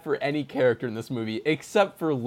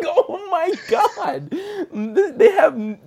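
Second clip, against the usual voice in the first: slow, high "Oh my God" through laughter; half laughing, half wailing; giggling mock despair; laugh-crying; 5 dB louder, much slower than usual. Real, not acted. Laugh-crying at nihilistic plot.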